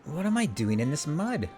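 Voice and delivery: puzzled outcry at waking in mud; startled heroine. Acted. Character wakes up in mud.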